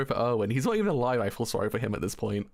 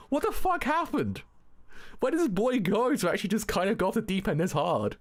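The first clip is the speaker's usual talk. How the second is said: baffled disbelief; 9 semitones higher than usual. Retold or imitated. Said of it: "I'm like".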